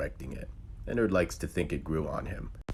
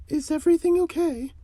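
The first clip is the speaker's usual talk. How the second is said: very high, louder mother character voice; concerned mother; 9 dB louder than usual. Acted. Mother asking while washing dishes, very high voice. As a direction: pitch far up in a questioning tone. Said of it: "asked the mother".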